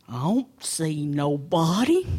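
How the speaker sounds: low, slow bear voice; dim, lumbering bear; puzzled bear. Acted. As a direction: puzzle it out in a slow, deep rumble. Slow bear talks to himself.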